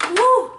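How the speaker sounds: high, loud woo of delight; sudden high-pitched woo; excited whoop. Real, not acted. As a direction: let out a happy woo as the spray hits. Spraying face, exclaims.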